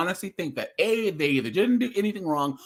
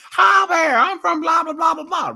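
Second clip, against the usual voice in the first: high, loud, hammed-up dumb American; mock a clueless yokel, shouting it much higher; 14 semitones higher, 8 dB louder than usual. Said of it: "they always go".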